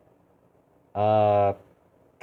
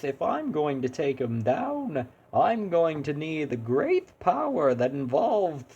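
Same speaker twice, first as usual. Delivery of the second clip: much higher, slow, sing-song cheerful hero; cheery Choose Goose voice; 7 semitones higher than usual. Acted. Hero talks to the boss about friendship, high and sing-song. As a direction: chirp it out, cheery but grim underneath. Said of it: "what comes to mind when I'm trying to do that voice".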